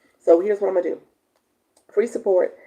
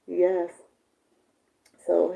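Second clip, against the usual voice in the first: ordinary delivery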